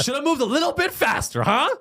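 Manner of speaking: high-pitched, laughing jeer; mock taunt. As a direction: rub it in with a sing-out taunt.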